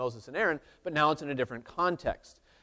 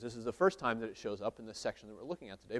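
ordinary talk

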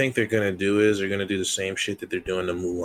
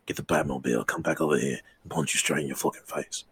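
fake British accent; 4 semitones lower, faster than usual. British Batman threatens to fetch the Batmobile, mock British accent. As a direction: lower British tough-guy grumble. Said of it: "fake accent that you're doing".

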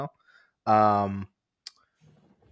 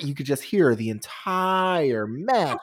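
ordinary talk

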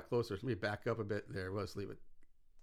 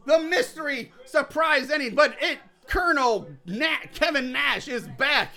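booming ringside play-by-play, pitched up; wrestling announcer; 5 semitones higher than usual. Pretend. Calling a mock wrestling match for the listeners, booming announcer. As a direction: hype the crowd like a wrestling commentator. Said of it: "I don't know why I'm still doing that".

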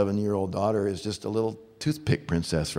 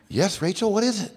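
high-pitched, indulgent dad reply; dad answering child; 13 semitones higher, 4 dB louder than usual. Retold or imitated. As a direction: answer the little one in a raised, singsong pitch. Dad answers yelling daughter.

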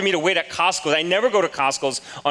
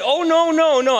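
higher and louder no, no, no; shocked denial; 10 semitones higher, 5 dB louder than usual. Retold or imitated. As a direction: protest in retold shock. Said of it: "I was so shocked".

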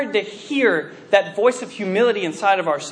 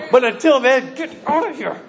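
mock angry dismissal; 4 semitones higher than usual. Acts ordering Jesus out.

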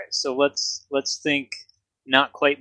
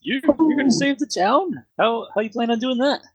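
high-pitched disbelieving Canadian barkeep; put-on Canadian innkeeper, taken aback; mock Canadian accent; 9 semitones higher than usual. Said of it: "And he had an accent".

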